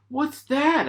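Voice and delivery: put-on very high curious little girl; squeaky piglet question; high childlike "what's that"; Peppa's voice.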